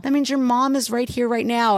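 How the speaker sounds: high, emotional imitation; moved insistence. Stranger telling her Mom is here, high moved insistence. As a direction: gush it as a heartfelt revelation.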